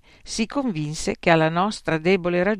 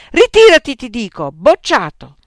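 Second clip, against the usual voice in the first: furious dismissal; 8 semitones higher, 14 dB louder than usual. Acted. Examiner shouting the student out.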